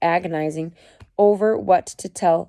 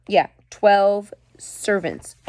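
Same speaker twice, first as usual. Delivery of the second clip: normal talking voice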